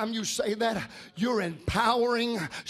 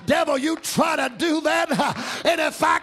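loud, ringing gospel preaching over the organ; full-force preaching; 6 semitones higher, 4 dB louder than usual. Acted. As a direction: preach it out loud to the whole church.

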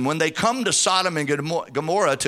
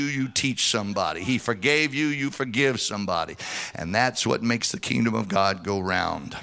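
usual speaking voice

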